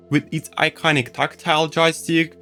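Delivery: normal talking voice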